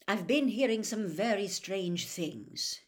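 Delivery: slow, hesitant admission; slowly. A narrator's reading. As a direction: let each word come reluctantly. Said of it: "he said slowly".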